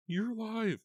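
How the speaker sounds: very high, loud cry of relief; joyful cry. A narrator's reading. Eva greets her lost friend, joyful high cry. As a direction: cry it out with joy. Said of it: "she cried".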